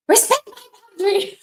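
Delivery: put-on high, loud child's voice; mock little boy insisting on his rights; mimicking son. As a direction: squeak it out like a defiant kid. Son quoted defiantly, high loud.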